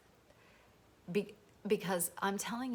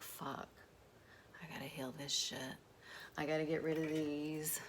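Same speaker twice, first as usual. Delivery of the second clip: whispered inner voice; 2 semitones lower, 5 dB quieter than usual. Retold or imitated. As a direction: mutter it to yourself under the breath.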